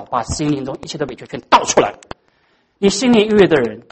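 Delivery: ordinary talk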